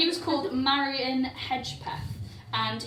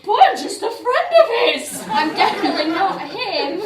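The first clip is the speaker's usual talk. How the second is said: hammed-up fake British accent, louder and higher with a laugh; bad British accent; 9 semitones higher, 14 dB louder than usual. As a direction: put on a terrible posh British accent, giggling. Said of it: "Probably had a really bad British accent".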